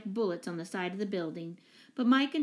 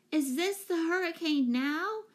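high, slow shout over the wind; shouted question; 9 semitones higher than usual. A narrator's reading. Jane shouting to the professor over the storm, high and slow. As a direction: yell it up against the storm. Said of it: "shouted Jane".

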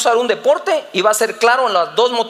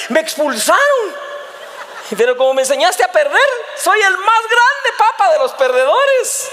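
high, exultant son, hammed-up; mock-gleeful child; 8 semitones higher than usual. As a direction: crow proudly in a put-on happy boy's voice. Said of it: "por qué estás tan alegre".

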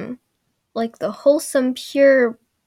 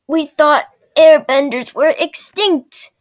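put-on slow, loud, raised old-woman voice; 5 semitones higher, 8 dB louder, slower than usual. Old villager marvelling at the airbender, slow mock proclamation. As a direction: drag out each word as a hammed-up elder. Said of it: "this old woman steps up".

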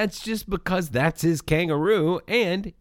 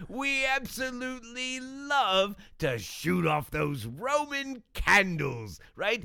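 put-on shitty Australian accent. Wallaby Wanker greeting podcast viewers in a hammed-up Australian accent. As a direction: play a cheesy Aussie safety mascot. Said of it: "your shitty Australian accent".

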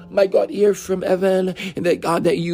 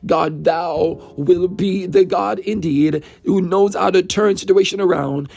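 ordinary delivery

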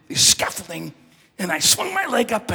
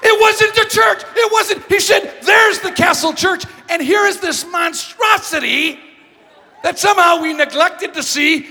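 much higher, louder, slowed emphatic telling; incredulous outburst; 13 semitones higher, 11 dB louder than usual. Real, not acted. Speaker telling the audience about the church mix-up, incredulous outburst. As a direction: hammer home the embarrassing mistake.

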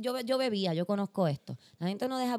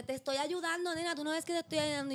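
higher-pitched tipsy lady insisting; a lightly voiced drunk old lady; mock heckler lady; 8 semitones higher than usual. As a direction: put on the old lady's whiny insistence. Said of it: "ella me dice".

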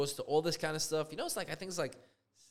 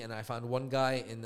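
usual speaking voice

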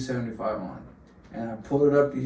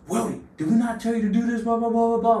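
high, louder put-on scold from a coach; mock coach scolding; 11 semitones higher, 5 dB louder than usual. Coach Rose scolding Willie after the lift, high mock yell. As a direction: ham up the angry coach. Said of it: "Coach Rose goes".

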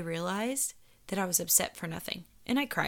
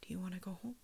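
soft whispered offer; 14 dB quieter, faster than usual. Retold or imitated. Friend offering to leave, whispered. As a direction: whisper a gentle way out. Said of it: "And she was like".

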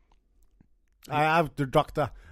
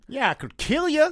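Texan doctor; 9 semitones higher than usual. Acted. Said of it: "I'm in Texas".

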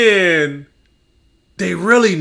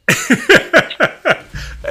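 laugh; 12 semitones higher than usual. Real, not acted. Laughing at fail posts.

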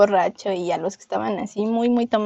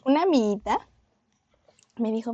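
ordinary delivery

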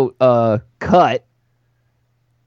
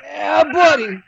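excited shout; much slower than usual. Real, not acted. Celebrating a rival player's cut.

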